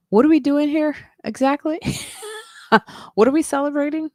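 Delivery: put-on high, puzzled child's question; puzzled kid voice. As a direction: ask it like a confused schoolkid. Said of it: "It's like".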